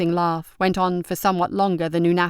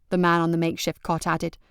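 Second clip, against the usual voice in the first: plain reading voice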